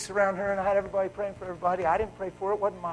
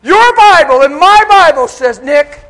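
loud, high, slow preacher roar; preaching at full force; 11 semitones higher, 22 dB louder, slower than usual. Acted. Preacher roaring to the congregation about God's power, loud and slow. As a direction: thunder it out over the church.

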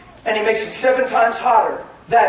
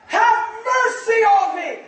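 high, slow pleading shout; yelling louder; 12 semitones higher, slower than usual. Acted. Bartimaeus crying to Jesus over the crowd, yelling louder. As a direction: stretch the plea out at a high shout. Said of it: "He yelled all the louder".

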